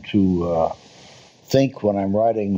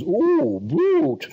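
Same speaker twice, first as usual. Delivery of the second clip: soaring high, drawn-out theatrical "Ooh, brute."; old-fashioned stage delivery. Retold or imitated. Old actor in a Wojciech scene, hammed-up high declamation. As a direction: declaim "Ooh, brute." like a pre-war German stage actor, pitch flying up. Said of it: "that was too old-fashioned".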